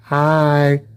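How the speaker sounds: a hint of a spooky animal saying hi. Animals in the boonies greeting the driver, low creepy voice. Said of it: "That's the animals talking to me".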